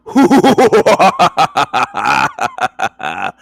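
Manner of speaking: loud high far-off cackle; boastful demonstration laugh; showing off own laugh. Shown as a demonstration. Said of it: "imitate my laugh".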